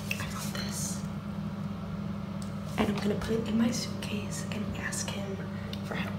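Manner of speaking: secret whisper; scheming whisper. Real, not acted. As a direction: whisper so he can't hear. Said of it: "very quiet".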